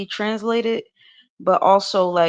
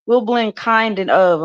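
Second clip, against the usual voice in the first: ordinary delivery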